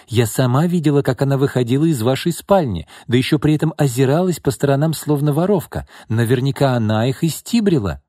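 high-pitched, insistent tattling; accusing. A narrator's reading. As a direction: pile on the accusation. Acted accusation in a quarrel.

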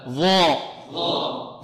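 ظاء drawn out very slowly and heavily; very slow emphatic ظاء for the class; articulation drill. Shown as a demonstration. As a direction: hold ظاء long with the tongue between the teeth. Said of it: "انطقوا معي".

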